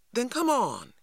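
sharp, high command; a lightly voiced curt command; ordering. A narrator's reading. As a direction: snap the order and turn away. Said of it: "he ordered".

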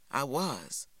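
usual speaking voice

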